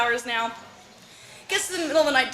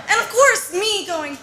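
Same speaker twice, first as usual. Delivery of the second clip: ordinary talk, nothing marked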